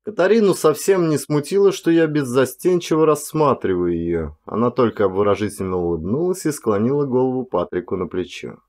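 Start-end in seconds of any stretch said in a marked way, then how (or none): none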